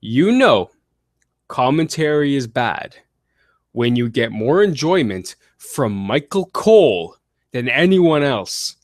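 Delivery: slow, deliberate emphasis. Shown as a demonstration. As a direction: spell it out slowly for the audience. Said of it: "and I'll say it slow".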